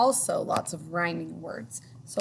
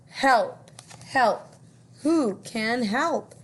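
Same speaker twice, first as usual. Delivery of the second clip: duck crying for help; 5 semitones higher, 5 dB louder, much slower than usual. Acted. Stuck duck calls for help.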